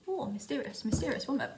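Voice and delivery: hushed, playfully mysterious; soft mock air of mystery; playful mysterious woman. Vlogger posing behind holly picks, playfully mysterious.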